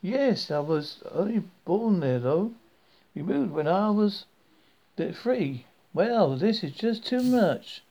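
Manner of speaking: high, delighted Southern woman; lightly voiced Southern gal; friendly Southern accent. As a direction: marvel out loud in a Southern lilt. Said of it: "speaking in a friendly southern accent".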